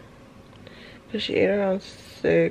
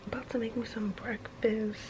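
whispering; 9 dB quieter than usual. Real, not acted. Baby calm, quiet morning.

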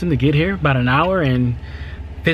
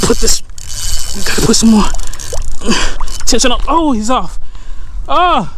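loud, high cries while fighting a fish; excited shouting; 9 semitones higher, 7 dB louder than usual. Real, not acted. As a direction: call out urgently mid-struggle. Fighting fish, then losing it.

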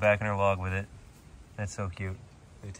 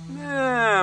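much higher squeaky bird voice saying no; voicing the bird; 6 dB louder than usual. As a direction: speak for the lazy bird. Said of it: "He'll pop his head out and look around and be like".

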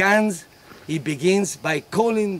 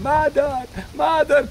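put-on high child's cry for mother; 9 semitones higher than usual. Child screaming for its mother, high cry. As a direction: call out like a frightened little child.

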